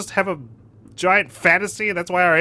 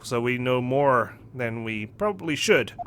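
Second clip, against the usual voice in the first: ordinary delivery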